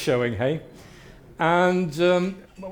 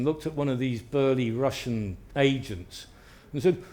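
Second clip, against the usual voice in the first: ordinary delivery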